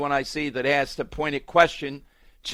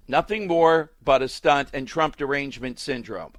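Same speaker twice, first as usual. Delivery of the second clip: ordinary delivery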